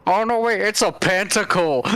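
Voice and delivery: normal talking voice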